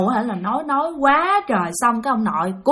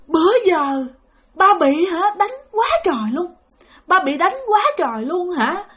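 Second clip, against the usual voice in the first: high, plaintive spirit voice; pained complaint; 7 semitones higher than usual. Retold or imitated. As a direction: imitate a tormented elder confessing his ordeal. Spirit tells of being beaten.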